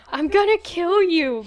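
high, loud, disgusted yell; a kid screaming after being slimed; furious yell. Retold or imitated. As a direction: yell it out in revulsion and rage.

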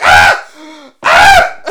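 loud comic screams of panic; short hammed-up screams, cracking up; mock freak-out screams. Manager freaks out just as bad as teller, mock panicked screaming. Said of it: "The manager freaks out just as bad".

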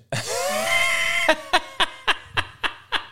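a high whooping laugh that half shouts; shouting laugh. Real, not acted. Streamer laughing off Jack Dugan, shouted laughter. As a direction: howl with laughter at him.

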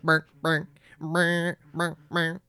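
low buzzing mock phone ring; phone ring imitation. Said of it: "it sounded like a phone, like".

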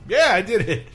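imitated priest confessing. Retelling a priest owning up, lightly imitated blurt. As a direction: blurt a breezy mock admission. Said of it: "he's like".

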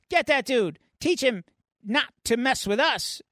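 high-pitched aggressive order; mock gang threat. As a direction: play a worked-up gang member. Acted gang member threatens Deadpool.

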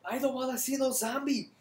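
high, loud mock Japanese accent; hammed-up Japanese accent. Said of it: "that's a Japanese accent".

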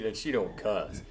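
ordinary talk, nothing marked

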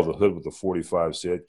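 usual speaking voice

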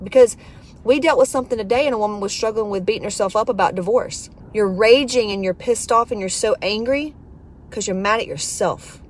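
normal talking voice

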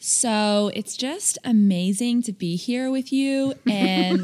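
put-on slow, drawn-out American Valley Girl; exaggerated American accent, words stretched. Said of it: "do your American accent".

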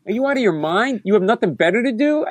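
high, incredulous comeback; indignant retort. Retold or imitated. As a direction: challenge the accuser's sanity. Acted quarrel with the accuser.

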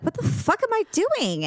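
high, incredulous self-scold; exasperated disbelief. Retold or imitated. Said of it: "I'm like".

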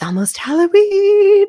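high, drawn-out singsong announcement; excited. Real, not acted. Podcast host announcing Halloween is near, excited singsong. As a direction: stretch it out with rising glee. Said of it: "today we're so excited".

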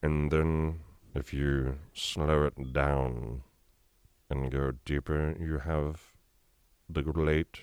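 very slow, deep, soft British drawl; Alan Rickman. Shown as a demonstration. Voice actor demonstrates a late British actor, slow and deep. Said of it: "great Alan Rickman".

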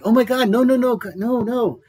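high, flustered pleading no's; panicky denial. Retold or imitated. Retelling how he begged the angry woman, flustered and high.

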